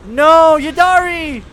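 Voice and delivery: desperate shout of the name; anguished shout. Real, not acted. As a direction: yell out as the friend gets taken. Character in danger in game.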